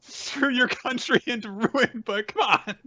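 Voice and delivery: high, pleading, half-laughing excuse; whiny villain plea. Acted. Orochi begging Hiyori for mercy, high whiny plea. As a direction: wheedle like a cornered coward.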